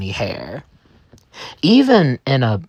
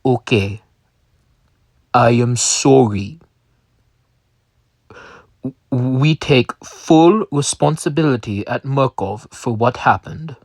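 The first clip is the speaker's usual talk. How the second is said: put-on Russian accent. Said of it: "he looked up and said in his Russian accent".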